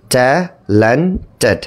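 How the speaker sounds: Talented split slowly into ট্যা, লেন, টেড; slow, segmented talented with gaps between syllables. Shown as a demonstration. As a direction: break talented into three separate beats. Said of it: "three syllables".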